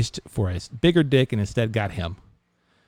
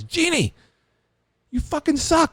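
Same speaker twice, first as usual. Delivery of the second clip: high-pitched acted outburst; mock-furious high call-out; angry confrontation. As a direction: yell it high at someone you blame. Patron kicks door, confronts genie.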